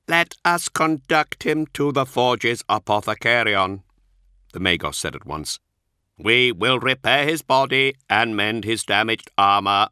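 a polite machine reciting its excuses; synthetic Magos voice. Acted. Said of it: "Archoi's synthesized voice".

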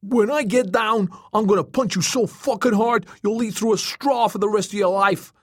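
high-pitched, furious threatening yell; shouted threat. A narrator's reading. Joey, tossed in the air, threatens Tom, furious shout. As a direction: yell the threat up at him angrily. Dangling boy threatens his tormentor.

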